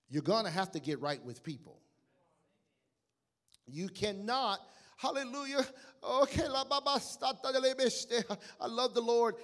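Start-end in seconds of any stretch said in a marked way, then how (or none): 5.0-9.4 high-pitched imitation of showy worship; raised, fervent mock-spiritual outburst; mock fervent praise. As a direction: put on ecstatic church praise and tongues. Mimics showy praise and tongues.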